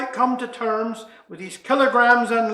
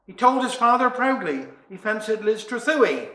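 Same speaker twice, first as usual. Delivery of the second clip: usual speaking voice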